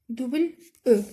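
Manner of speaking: ordinary talk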